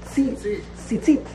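tzitzit as "tzee-tzeet", long vowels; final T sound. Shown as a demonstration. Teacher modelling the easier form, long vowels and final t. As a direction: finish tzitzit on a clear t. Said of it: "we say the tough".

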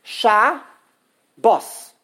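"sha" and "bos" loud, split by long pauses; syllable by syllable. Shown as a demonstration. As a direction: punch each syllable separately: sha, bos. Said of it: "there are two syllables".

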